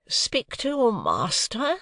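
wife affronted by a maid; roused dignity. A narrator's reading. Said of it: "whose dignity was roused again".

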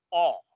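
"O" as long open aw; third A sound. Shown as a demonstration. Teacher recapping three sounds, open aw vowel. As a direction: say "O" with throat open. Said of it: "ya tenemos tres sonidos".

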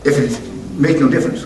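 high, loud preaching with a laugh; fiery pulpit delivery. Acted. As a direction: press the point on the congregation. Preacher building the point.

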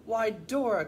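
lightly voiced duck, loud opening; storybook duck, mildly surprised; quacking duck. A narrator's reading. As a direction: call out with a hint of a quack. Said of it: "quacked Debbie".

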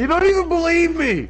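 high-pitched yelled protest; exasperated shout. Real, not acted. Parent protesting to a disbelieving child, exasperated shout. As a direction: yell it high, at wit's end.

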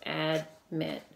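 "Admit" slowly broken after Ad; syllable by syllable. Shown as a demonstration. Teacher showing pupils where the word breaks, "Admit" split in two. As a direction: say "Admit" as two beats, Ad, mit. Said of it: "break it right there".

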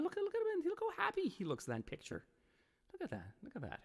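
squealing high with delight over a photo; gushing delight. Real, not acted. Streamer squeals over a tiger photo, gushing. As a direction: coo over the happy picture.

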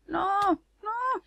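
high, wailing no; panicked wail. Real, not acted. Player wailing as her character drowns, panicked.

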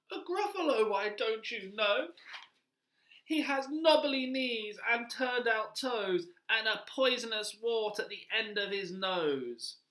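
high-pitched mouse voice; little mouse. Acted. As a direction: answer as a polite, squeaky little mouse. Mouse describes the Gruffalo.

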